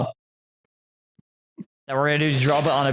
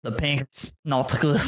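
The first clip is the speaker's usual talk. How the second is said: low, slow attempt at Nolly's British accent; 5 semitones lower, 3 dB quieter, slower than usual. Caster mimics Nolly complaining about ping, British accent. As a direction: imitate a British player in a slow, lowered accent. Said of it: "British accent of "the lobby's not good".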